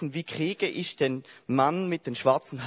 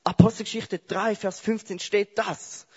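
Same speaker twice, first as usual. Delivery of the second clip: a smug Bible quoter scoring a point; know-it-all debater; 7 semitones higher, 3 dB louder than usual. Pretend. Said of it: "Und dann der andere". (besserwisserisch, höher und langsam, zitiert einen Bibelvers)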